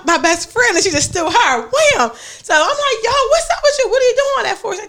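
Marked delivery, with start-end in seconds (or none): 1.6-2.1 high exclaimed wham; acted impact. Telling friends about the best friend getting hit, exclaiming. Imitates second blow. 3.0-4.7 high-pitched bewildered protest; mock alarm. Retelling confronting the friend mid-fight, in high alarm.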